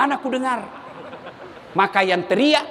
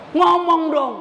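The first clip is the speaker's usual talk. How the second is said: normal talking voice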